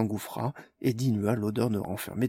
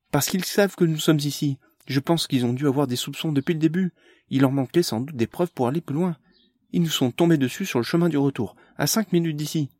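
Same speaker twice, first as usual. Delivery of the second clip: put-on urgent young woman, a bit higher and louder; tense girl reporting the ambush; lightly voiced young woman; 5 semitones higher, 5 dB louder than usual. A narrator's reading. Amélie explains the ambush, tense and higher.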